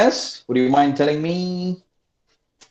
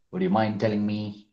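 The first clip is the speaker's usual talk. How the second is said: ordinary talk, nothing marked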